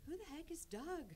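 high-pitched incredulous questioning; baffled. Retold or imitated. Widow wondering about the crying stranger on the phone, baffled. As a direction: puzzle it out in a high, bewildered voice.

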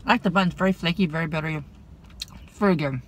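speaking between chews; chewing while talking. Real, not acted. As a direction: mouth partly full. Seen 0: bites croissant at -3, cheeks bulge and jaw works while speaking.

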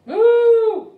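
huge, high, long moo; cow moo. Acted. Puppy George answering his mother, booming high moo. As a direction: bellow like a cow at top volume.

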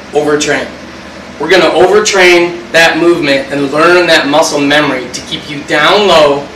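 ordinary delivery

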